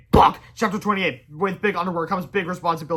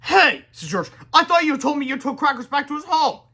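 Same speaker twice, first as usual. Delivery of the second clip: surprised; 9 semitones higher, 6 dB louder than usual. A narrator's reading. Boy confronts friend.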